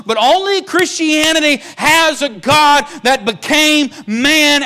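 high, loud, slow preacher's cry, sustained; preaching at full force. Acted. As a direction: shout it out from the pulpit, rising high. Preaching climax.

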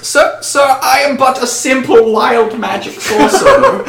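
high-pitched nervous elf plea; hammed-up pleading to a superior. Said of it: "in a pleading fashion".

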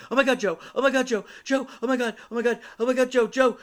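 high, loud panicked shrieks; imitated screaming. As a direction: shriek it over and over, imitating a frantic caller. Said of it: "screaming at the top of her lungs".

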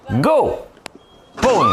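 high, loud "Go!" and then "Boum!"; loud comic impact noises; mock impact. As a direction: call out the crash. Replaying barrier hit.

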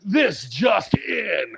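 slow, high, stretched announcement; urgent news announcer. Acted. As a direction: proclaim it like breaking news.

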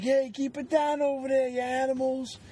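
high yelling neighbor across the hall; cranky neighbor. Retold or imitated. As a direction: bark at the noisy neighbors. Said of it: "It's like".

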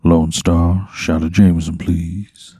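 low, smooth R and B croon; sultry R and B voice. Acted. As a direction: order it low and silky. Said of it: "So you say, like".

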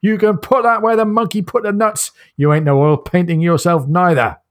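Cockney soldier snapping back; a light Cockney accent, stung; Cockney retort. Retold or imitated. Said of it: "speaks English with very much what seems like a Cockney".

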